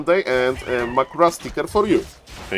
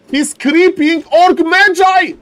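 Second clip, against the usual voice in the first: ecstatic shout; 7 dB louder than usual. Real, not acted. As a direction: scream with disbelief at the play. Caster shouts at big play.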